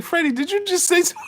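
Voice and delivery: high, fast confronting squeak; mock Robert Garcia. Robert Garcia confronting Freddie Roach, high and fast. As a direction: squeak it out fast, picking a fight. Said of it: "He was like".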